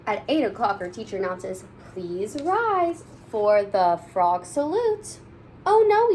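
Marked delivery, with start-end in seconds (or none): 1.9-5.2 slow, drawn-out teacher announcement. Acted. Teacher announcing the morning salute, slow and grand. 5.6-6.1 high, loud "oh no"; shout. Acted. Kids shouting at their teacher, loud and high. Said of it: "we shout".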